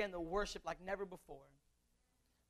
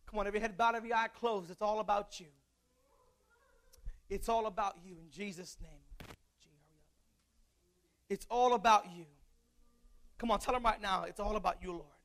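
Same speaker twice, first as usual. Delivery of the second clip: pleading preacher altar call; 3 semitones lower, 4 dB quieter than usual. Acted. Altar call prayer, soft.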